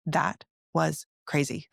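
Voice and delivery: slow Southern drawl; drawn-out Southern delivery, word by word; Southern accent. Retold or imitated. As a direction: imitate a friend's cute Southern accent, slowly. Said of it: "With her cute little Southern accent".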